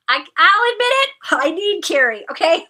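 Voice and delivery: loud, high mock-desperate confession; hammed-up admission of need; playful outburst. Mock confession of needing a friend.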